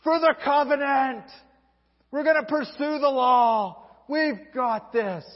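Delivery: high, loud rallying call, slowing at the end; rallying cry. Retold or imitated. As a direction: fire up the people for the covenant. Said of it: "and Mattathias says".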